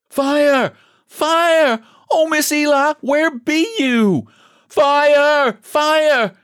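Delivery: high, urgent shouts of fire; crying out the alarm. A narrator's reading. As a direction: raise the alarm, calling out to the neighbors. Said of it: "Hoarse shouts followed her cries".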